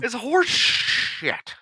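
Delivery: high, very slow stretched curse; drawn-out griping. Real, not acted. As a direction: stretch out each word in protest. Exaggerated griping about slow computers.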